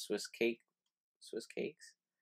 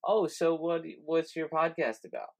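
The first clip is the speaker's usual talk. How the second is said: higher, curious question voice; curious asker; 9 semitones higher, 5 dB louder than usual. Retold or imitated. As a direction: voice the asker with interest. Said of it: "People ask me".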